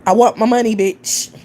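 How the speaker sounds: playful loud demand for money; mock demand. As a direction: bark it out like a boss collecting. Demanding payment from broke chatters.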